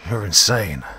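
a mild narrated scream at the captor; light scream, not full force. A narrator's reading. Hero screams at his infected friend, a mild yell. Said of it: "I screamed".

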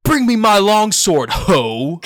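loud high shouted call to arms; hammed-up battle cry. Schoolboy playing Friar Lawrence hams up his line in class, shouting. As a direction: bellow it like a warrior calling for his sword. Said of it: "it's like inflection".

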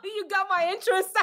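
real laugh through the words; laughing, curious and amused. Real, not acted. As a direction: laugh while you say it.

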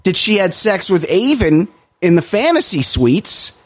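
high, slow, sarcastic mock of the show's producers; sneering imitation of producers pretending nothing happened. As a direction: drag it out in a high, taunting voice. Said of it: "They're like".

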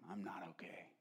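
a whispered, worn-out reply from a hurting woman; whispered pain. Retold or imitated. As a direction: breathe it out, barely voiced. Old woman in pain replies.

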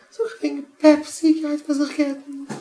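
very high, loud put-on voice; exaggerated high-pitched lament; mock wail. Speaker mocking a split over Pepsi and Coke, high mock wail.

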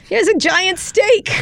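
high, slow showman offering a steak; Scaramucci impression. Retelling his dinner with Scaramucci, high and slow.

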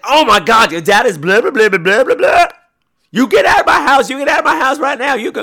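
loud, high, hammed-up yelling; mock angry yell. Fisherman yells at the girl to leave, mock furious. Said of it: "And he's like".

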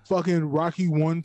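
ordinary talk, nothing marked

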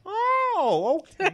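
high laughing burst; real laughter; laughing shout. Real, not acted.

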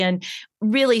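usual speaking voice

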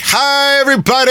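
high, sing-song flamboyant greeting; flamboyant flight attendant. Retold or imitated. Passenger imitating a flamboyant flight attendant greeting the cabin, high and camp. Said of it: "This flight attendant came down here flamboyant".

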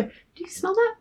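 high-pitched mock curiosity; acted, high rising query; curious asking. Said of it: "he asks her".

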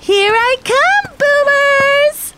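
high, drawn-out gleeful yell; charging war cry. Acted. Racer charging at the boomers, high drawn-out yell. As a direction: holler it high and long while charging.

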